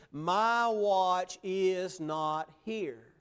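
slow, emphatic, every word weighted. Retold or imitated. Preacher voicing a searcher giving up on a watch, slow emphatic. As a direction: lay each word down slowly and firmly. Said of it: "To say that emphatically".

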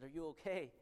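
ordinary talk, nothing marked

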